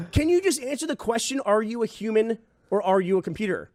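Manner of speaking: high-pitched insistent questioning; exasperated demand. Retold or imitated. He demands the caller say if human, exasperated.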